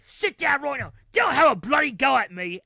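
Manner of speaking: a hint of an enraged British dad; British angry dad. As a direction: shout it in an angry British accent. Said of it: "the accent made it even worse".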